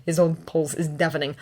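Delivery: ordinary delivery, nothing marked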